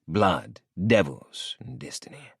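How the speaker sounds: plain reading voice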